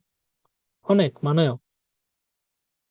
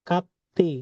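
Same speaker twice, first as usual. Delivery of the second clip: ordinary talk, nothing marked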